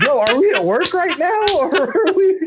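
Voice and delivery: high, laughing incredulous retort; recalling overhearing the boss, laughing in disbelief. Retold or imitated. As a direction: squeal it out in amused disbelief. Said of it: "And I'm like".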